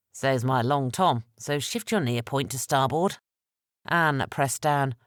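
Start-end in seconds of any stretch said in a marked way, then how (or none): none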